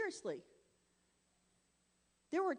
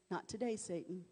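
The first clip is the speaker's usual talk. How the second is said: quiet firm refusal; 5 semitones lower, 10 dB quieter than usual. Shown as a demonstration. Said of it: "when it's time to say".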